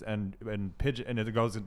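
ordinary talk, nothing marked

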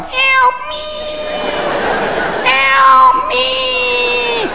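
tiny high-pitched cry for help; The Fly. Acted. Hungover man as the movie fly, high squeal. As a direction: squeal it, long and drawn out. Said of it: "scene from the movie The Fly going".

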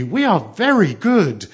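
high, loud, emphatic eagerness; slow, emphatic childlike assurance; eager assurance. A narrator's reading. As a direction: insist earnestly, leaning on each word. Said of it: "they answered eagerly".

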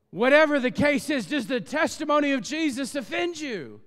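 high, loud fervent preaching. Acted. Pastor pressing the crowd about offense, loud preaching. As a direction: build it up, pleading with the crowd.

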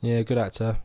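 usual speaking voice

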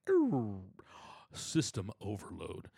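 slow, low robotic voice, like a machine crashing; a scared guy turned into a shutting-down robot; robot overload. Retold or imitated. A guy frozen at the mic, robotic and slowed down. Freezing up when handed a mic.

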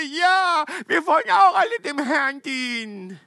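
hammed-up eager crowd agreeing; mock eager crowd. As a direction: ham up a keen crowd shouting along.

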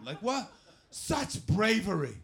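loud, slow, high proclamation; hammed-up marvelling; mock awe. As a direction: draw it out as overblown admiration. Mock awe at sober pie eaters.

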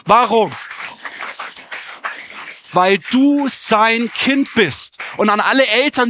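high, forceful sermon delivery; impassioned preaching. Real, not acted. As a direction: drive it home to the congregation. Preaches with full force to congregation.

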